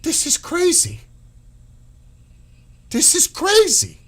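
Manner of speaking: high, loud, incredulous cry; can't believe what he's reading; real exasperation; shocked disbelief. Real, not acted. Outraged reaction to the deal.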